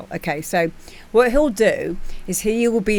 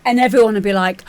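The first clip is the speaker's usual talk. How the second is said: normal talking voice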